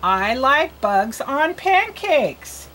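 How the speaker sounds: very slow, word by word, stretched; reading for learners. Shown as a demonstration. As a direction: read "I like bugs on pancakes" slowly for a beginner. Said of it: "You read it".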